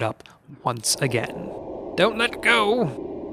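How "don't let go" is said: slow, high, pleading little voice; a timid paper creature pleading slowly; begging paper tiger. A narrator's reading. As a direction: beg them desperately not to let go. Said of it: "begged the paper tiger".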